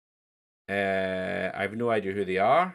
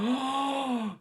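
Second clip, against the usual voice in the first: gasp; 14 semitones higher than usual. Acted. Sudden thought mid-chat.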